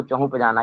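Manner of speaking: "चहुँ पे जाना" with ch and p swapped; village mispronunciation. Shown as a demonstration. Teacher showing village speech, mispronounced "चहुँ पे जाना". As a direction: swap consonants, giving "चहुँ पे जाना". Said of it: "यह कौन सी भाषा है".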